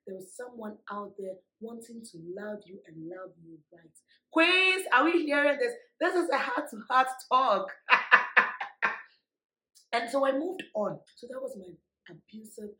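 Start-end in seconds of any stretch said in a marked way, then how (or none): 4.3-7.7 high, loud rallying call; rally the audience, up and loud; calling out to viewers. Real, not acted. Calling to women watching, loud and high. 7.8-9.0 loud airy laugh; breathy laugh. Real, not acted. Laughing with the women watching.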